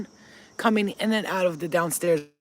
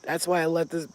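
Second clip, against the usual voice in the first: usual speaking voice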